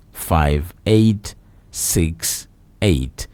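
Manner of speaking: reading out a phone number slowly, digit by digit. Acted. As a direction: spell the line out slowly for listeners. Repeats the station number.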